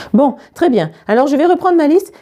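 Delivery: a hint of a brisk teacher; lightly voiced teacher. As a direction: lightly play a teacher getting back to business.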